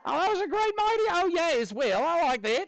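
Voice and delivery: put-on high-pitched, excited Australian accent; Aussie accent. Teacher praising the class's mighty oh yeah, high Aussie accent. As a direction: cheer them on in a playful Aussie voice. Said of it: "we'll do this in an Aussie accent".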